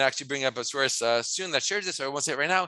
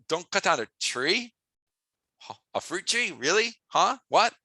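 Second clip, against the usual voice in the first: disbelieving questions, rising and halting; mock incredulous; 3 semitones higher than usual. Soldier told to spare a tree in wartime, mock incredulous.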